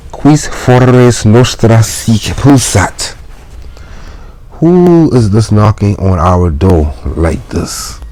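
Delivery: grumpy janitor. Acted. Janitor asking who knocks. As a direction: ask who's banging on the door.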